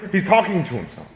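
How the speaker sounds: loud, high, fervent preaching; preaching at full force. Acted. Pastor urging the congregation to talk to themselves, fervent loud preaching. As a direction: lift it up and drive it home from the pulpit.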